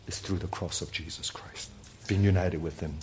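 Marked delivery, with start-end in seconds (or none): 0.1-1.7 hushed, intimate close; whispered emphasis. Real, not acted. Pastor dropping to a whisper on the cross, whispered. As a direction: let it fall to a whisper.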